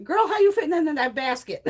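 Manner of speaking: high, loud mock address to the girl; sassy disbelief. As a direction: call out to her in amused disbelief. Sassy thought addressed to farmer woman.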